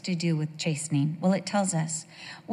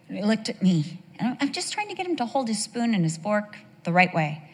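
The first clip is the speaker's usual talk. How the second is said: ordinary talk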